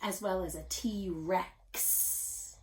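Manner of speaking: T-Rex said slowly, ks ending stretched; slow on Rex to show the ending; drawn out T-Rex. Shown as a demonstration. Teacher pointing out the X ending, slow. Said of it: "I hear the X at the end".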